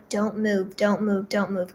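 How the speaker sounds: soft, murmured don't move to herself; hushed, steadying herself through fear; quiet self-talk. Retold or imitated. Patient calming herself while doctor hunts a vein, hushed murmur. Telling herself to stay still.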